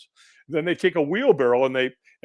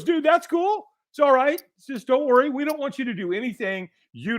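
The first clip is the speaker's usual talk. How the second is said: high-pitched, placating dude voice; easygoing reassurer; 9 semitones higher than usual. Acted. Staffer telling a reluctant walker it's fine, placating. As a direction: reassure him in a much higher voice.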